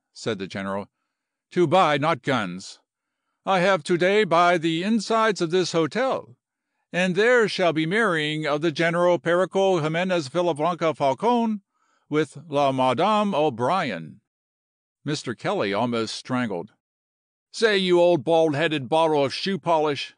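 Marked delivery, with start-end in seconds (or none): 1.5-14.2 loud, high formal announcement; proud Latin general. Acted. General tells Kelly he bought the hotel, proudly declaiming. 17.5-20.1 loud, high-pitched indignation; sputtering outrage. Acted. Kelly cheated out of his deal, sputtering angrily. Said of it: "he sputtered".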